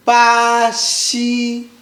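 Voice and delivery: BA XI, both syllables long and level; first tone. Shown as a demonstration. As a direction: hold ba and xi flat on the same pitch. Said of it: "make sure it's flat".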